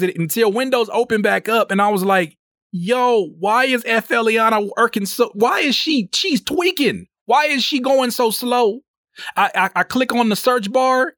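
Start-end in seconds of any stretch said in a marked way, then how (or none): 2.7-8.8 high, rising panic at the slow computer; alarmed disbelief. Retold or imitated. To himself at the stalling computer, high alarmed disbelief. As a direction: freak out, baffled.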